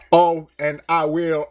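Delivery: slow mock Southern drawl; lightly done Southern accent. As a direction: put on a mock Southern twang. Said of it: "go down to the American South and say".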